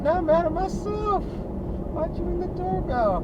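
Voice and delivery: hammed-up high voice scolding herself; mocking self-scold. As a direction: squeak it like a mock nag.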